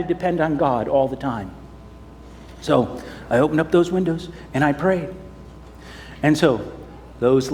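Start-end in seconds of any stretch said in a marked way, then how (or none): none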